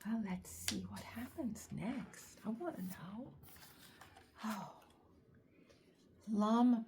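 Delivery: usual speaking voice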